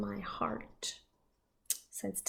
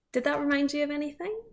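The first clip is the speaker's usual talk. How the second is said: ordinary delivery, nothing marked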